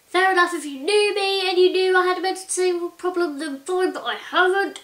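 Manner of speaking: mock scream of pent-up annoyance; inner scream. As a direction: let out the frustration she holds in inside. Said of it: "like, screaming".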